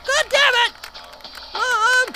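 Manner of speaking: put-on kid's yell. Kid yells at mom over bath time, high whiny protest.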